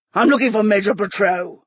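muffled growl from a helmet; inside a helmet. A narrator's reading. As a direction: speak as if through a helmet visor.

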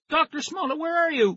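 very high, searching call. A narrator's reading. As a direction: call into empty rooms. Calling for the missing doctor.

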